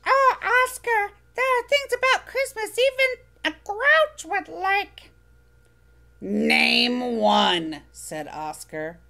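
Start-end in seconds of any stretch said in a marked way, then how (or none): 0.1-5.1 high, bright little furry monster voice, eager; squeaky childlike monster, proud; Elmo voice. Acted. Elmo coaxing Oscar. 6.2-7.8 louder grumbling grouch, slow and relishing; gleefully sour, savor every complaint; Oscar grouch. Acted. Oscar challenging.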